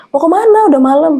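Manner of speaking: high-pitched puzzled onlooker; surprised questioning. Retold or imitated. Neighbours ask a couple out walking at night, surprised questioning.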